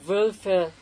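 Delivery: Wölfe said the German way, two syllables, wöl-fe; slow German Wölfe, both syllables clear. Shown as a demonstration. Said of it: "has two syllables".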